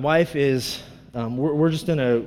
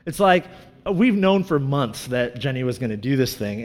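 ordinary talk

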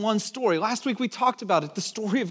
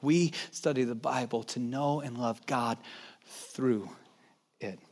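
tender; 9 semitones lower, 7 dB quieter than usual. Acted. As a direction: let it land gently. Tender sermon climax.

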